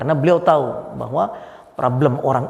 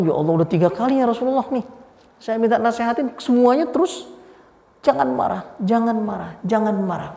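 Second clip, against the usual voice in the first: mock-exasperated petitioner, pitched higher; exasperated complaint; 6 semitones higher than usual. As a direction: imitate someone fed up with hearing one answer. Petitioner complains at repeated same answer. (keluhan kesal yang ditirukan, bernada tinggi)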